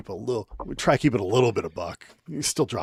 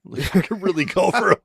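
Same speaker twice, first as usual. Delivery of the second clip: put-on whiny kid; 7 semitones higher, 4 dB louder than usual. Kid whining for a soda.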